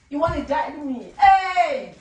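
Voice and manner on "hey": higher, louder warning call; sharp shout. Acted. Wife scolding husband, sharp shout. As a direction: burst out in reproach.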